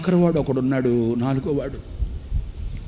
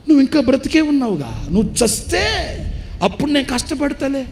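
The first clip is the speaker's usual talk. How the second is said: scornful son's shout. Retold or imitated. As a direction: fling it at the old father like a heartless son. Son's cruel retort to father.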